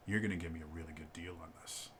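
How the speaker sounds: a hushed, pushy demand; quiet and close. Retold or imitated. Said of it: "he gets real quiet".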